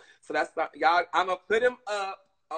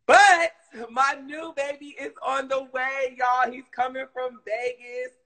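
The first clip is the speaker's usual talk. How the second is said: excited; 8 semitones higher, 4 dB louder than usual. Real, not acted. Announcing new puppy excitedly.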